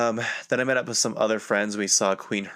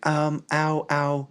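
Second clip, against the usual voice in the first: higher-pitched mock "ow"; imitated pained protest; 8 semitones higher, much slower than usual. Friend with a broken arm gets grabbed, mock pained ow. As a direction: act out a friend's polite little yelp.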